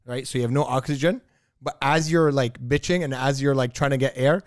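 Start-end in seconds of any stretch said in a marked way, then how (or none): none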